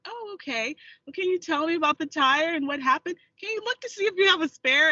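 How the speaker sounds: politely concerned, lifted voice; kindly phone lady. Retold or imitated.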